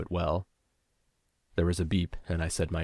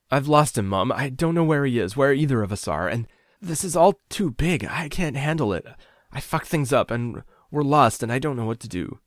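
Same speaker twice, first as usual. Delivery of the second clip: high, loud, desperate outpouring; panicked babbling; 7 semitones higher, 5 dB louder than usual. A narrator's reading. Lost caller rushing confession to mother, panicked babbling. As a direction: let it all spill out, near breaking.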